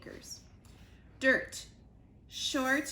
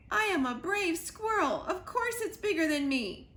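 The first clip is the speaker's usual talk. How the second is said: high, louder proud squirrel; indignant squirrel; 8 semitones higher, 4 dB louder than usual. Acted. Squirrel insisting to Dirt he is brave. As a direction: puff up and insist you're brave.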